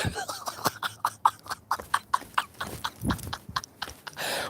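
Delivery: helpless, voiceless giggling fit; long breathy laugh. Real, not acted. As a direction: crack up until only air comes out. Cracks up after reading a comment.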